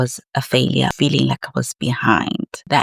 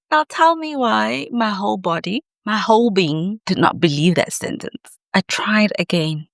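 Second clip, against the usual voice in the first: usual speaking voice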